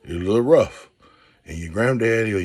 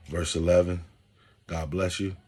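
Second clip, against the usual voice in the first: ordinary delivery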